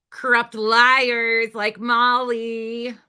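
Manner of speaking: slow, loud and pointed, each word hammered; pointed jab. Real, not acted. Streamer hits back at the clip, slow and loud.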